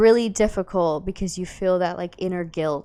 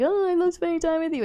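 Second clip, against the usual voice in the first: a gushing, sugary voice pitched much higher; mock lovey-dovey; 11 semitones higher than usual. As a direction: ham up a besotted girlfriend. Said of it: "It's not just all like".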